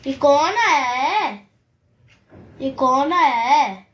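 slow singsong question with long vowels; drawn-out cooing. Real, not acted. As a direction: stretch it out playfully for a pet. Coaxing talk to pet birds. (लंबा खींचकर, लाड़ भरा सवाल)

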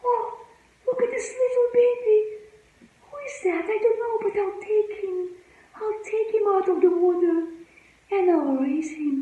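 put-on high, fast and bright, a princess cooing over a baby; delighted princess. Princess finds a baby in the river, coos high and fast. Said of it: "and she said".

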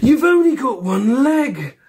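put-on high, loud child's voice; bright child asking loudly; kid voice. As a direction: pipe up like a blunt little kid.

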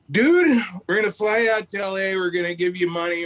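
high opening burst, easing as it goes; re-enacted hyped phone pitch; lightly acted thrilled announcement; excited good news. Casting caller delivers big news.

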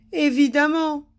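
high, loud cross retort; grumbling. A narrator's reading. King answers Madame Bonheur, grumbling. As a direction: grumble it as a peevish wail. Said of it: "grommela le roi".

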